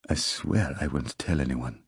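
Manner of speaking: low, hushed, mock-fearful promise; mock frightened. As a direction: play at being scared. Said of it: "put on a frightened look".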